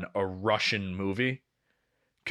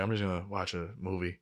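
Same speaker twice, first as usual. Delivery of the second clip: quiet; 5 semitones lower, 9 dB quieter than usual. Retold or imitated. Quiet beside sleeping girlfriend.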